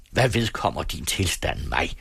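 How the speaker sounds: ordinary delivery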